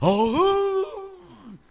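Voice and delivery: drawn-out high roaring growl; mock lion roar with a cry in it; lion roar impression. Shown as a demonstration. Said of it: "비슷한가?".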